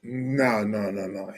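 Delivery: normal talking voice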